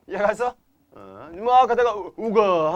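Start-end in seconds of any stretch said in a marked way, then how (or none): 1.3-2.7 higher and louder, stretched 우- then a loud 우가; playful shout. Shown as a demonstration. Teacher to class, acting out the stop codon with a playful shout. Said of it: "하면 끝내는 거라고".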